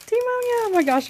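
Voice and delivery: very high excited squeal of the name. Real, not acted. Shoe fan greeting her new pair, excited squeal. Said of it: "I'm so excited".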